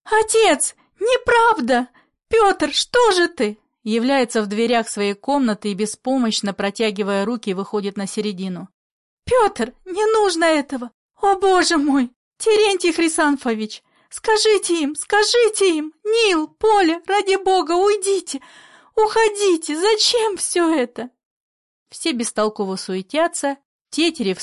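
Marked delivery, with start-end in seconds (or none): 0.0-3.6 very high, loud distressed cry. A narrator's reading. Daughter protests to father and brother, crying out. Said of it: "Татьяна кричит". 9.2-21.1 high-pitched, pleading outcry; distraught cries. A narrator's reading. Daughter begs everyone to stop and leave, distraught. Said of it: "Татьяна кричит".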